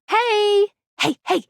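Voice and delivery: high, insistent hey; calling for attention. Acted. Guide interrupting the player with a tip, calling out. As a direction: call out to break in on someone.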